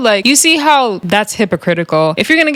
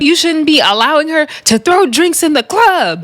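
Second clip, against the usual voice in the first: mock scolding; 7 semitones higher than usual. Retelling a castmate's scolding.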